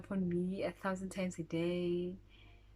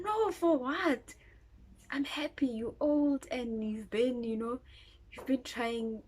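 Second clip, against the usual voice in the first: mother's excited reply; 6 semitones higher, 4 dB louder than usual. Retold or imitated. Mother's voiced reply in story.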